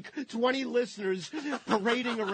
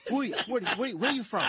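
higher, hammed-up demand; mock interrogation; 7 semitones higher, much faster than usual. Host acting out grilling a tourist, hammed-up demand. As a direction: bark a tourist down, half joking.

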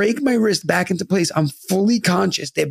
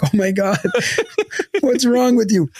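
imitated exasperated mom; 5 semitones higher than usual. Mom scolding her drugged child.

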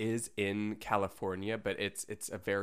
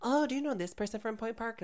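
put-on young fan voice; 11 semitones higher than usual. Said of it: "people all the time ask me".

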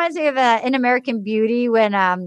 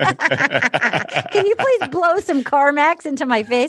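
joking robot voice; much slower than usual. Pretend. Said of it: "literally looking like a robot".

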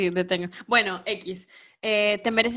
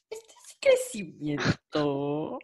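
drawn out; 4 semitones lower, much slower than usual. Real, not acted. Drawn-out mock-emotional line.

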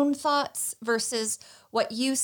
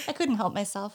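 ordinary talk

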